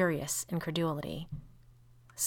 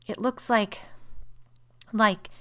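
halting, higher-pitched horror; horrified stammer; 5 semitones higher, 4 dB louder, slower than usual. A narrator's reading. Sister cannot name what the shadow resembles, stammering in horror. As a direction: break off and stumble on the word. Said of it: "stammered Missus Brigham in a tone of intense horror".

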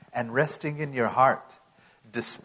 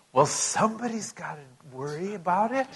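imitating a worrier; 4 semitones higher than usual. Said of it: "And they said".

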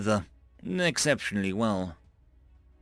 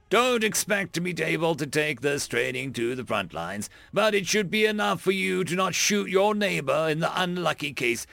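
drill instructor; 5 semitones higher than usual. Acted. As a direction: bark it at the recruits. Instructor addresses trainees.

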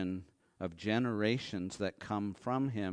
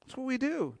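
usual speaking voice

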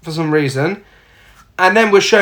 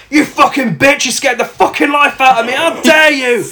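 mock furious rant; 6 semitones higher than usual. Startled hunter raging at Alison, mock fury. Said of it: "he's like".

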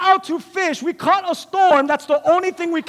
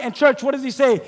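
usual speaking voice

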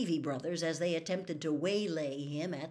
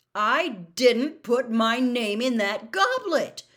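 louder and slow, each word pressed out in anger; growing anger; 6 semitones higher, 11 dB louder than usual. A narrator's reading. Harry to a disbelieving Ron, slow louder rising anger. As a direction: repeat the denial, now losing patience. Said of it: "starting to feel angry".

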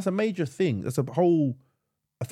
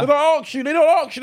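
emphatic complaint; 12 semitones higher, 6 dB louder than usual. Real, not acted.

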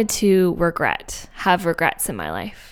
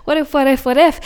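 higher, giddy what-ifs tumbling out; dreamy excitement; 6 semitones higher than usual. Retold or imitated. Two friends in dreamland together, giddily wondering. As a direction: daydream aloud, repetitions climbing in pitch. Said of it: "in dreamland being like".

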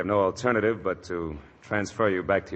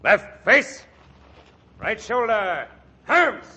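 drill commands; 6 dB louder, much slower than usual. Acted.

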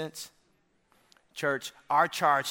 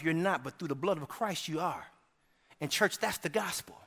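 usual speaking voice

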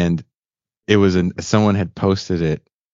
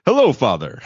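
put-on higher, neat accentless newsreader parody; mock anchor voice; 6 semitones higher than usual. Kids greeting dad like news anchors, mock anchor voice. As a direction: impersonate kids who talk like anchors. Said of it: "anchor people".

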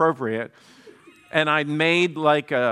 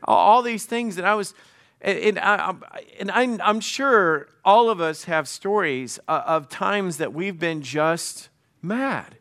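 normal talking voice

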